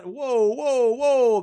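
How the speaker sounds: high, loud calls to stop; acted alarmed yell. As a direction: imitate staff rushing in to stop someone. Staff trying to stop thief.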